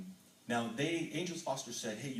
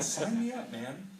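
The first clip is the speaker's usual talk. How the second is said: high, loud laughing sign me up; thrilled, laughing agreement; eager laughing yes; 10 semitones higher, 5 dB louder, slower than usual. Retold or imitated. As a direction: jump at the chance with a laugh. Accepting the promotion eagerly.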